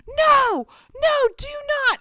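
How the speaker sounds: high, loud mock scream; imitating a panicked, amused brother; screaming protest. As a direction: yell it in helpless protest. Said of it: "he was screaming with laughter".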